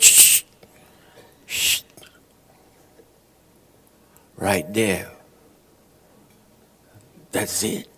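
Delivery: hushed, breathy secret pointing out; shushing whisper. Retold or imitated.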